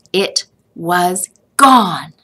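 ordinary delivery, nothing marked

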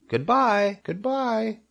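high, piping repeated farewell; little creature voice calling high; tiny spiders calling. A narrator's reading. Baby spiders floating off, calling goodbye high. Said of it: "they called".

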